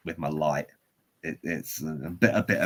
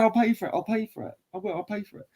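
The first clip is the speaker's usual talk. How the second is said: high, pleading repetition; grovelling offer; 12 semitones higher, faster than usual. Retold or imitated. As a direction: imitate yourself desperately appeasing someone. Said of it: "I was all grovelly and apologies".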